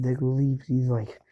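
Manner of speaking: plain reading voice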